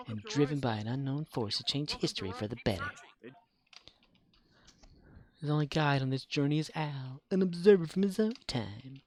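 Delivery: dramatic TV announcer recitation; show intro narration. Acted. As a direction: narrate it like an old TV opening. Recites Quantum Leap show intro.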